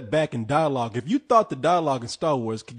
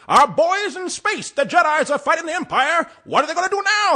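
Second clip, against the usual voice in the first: put-on old newsreel; 12 semitones higher, 4 dB louder than usual. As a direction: boom it out like a wartime newsreel. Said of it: "sounds like a World War Two newsreel announcer".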